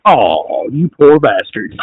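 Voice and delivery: slow drawn-out mock sympathy, dropping low; mock pity. Host answering chat's wrestling complaints, mock pity. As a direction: draw it out with feigned pity. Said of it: "And I'm like".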